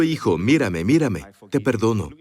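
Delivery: low, gentle fatherly forgiveness; a father holding his son's eyes; tender father to son. Shown as a demonstration. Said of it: "Vean a sus hijos a los ojos".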